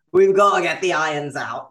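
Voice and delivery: a hint of a bossy English tutor; mock British accent. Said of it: "They're like".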